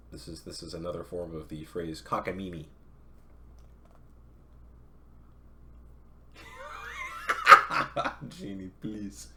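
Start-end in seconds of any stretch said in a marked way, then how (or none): 2.7-8.2 loud, high laughing outburst; burst of laughter. Real, not acted. Streamer bursting out laughing at a chat message. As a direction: crack up at the chat joke.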